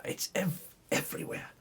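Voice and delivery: breathy, secretive whisper of the word everywhere; whispered aside to the listener. Shown as a demonstration. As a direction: let the second everywhere drop to a hushed whisper. Repeats phrase as a whisper.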